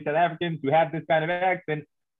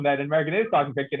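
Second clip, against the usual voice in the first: usual speaking voice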